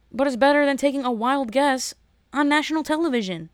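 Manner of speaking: ordinary delivery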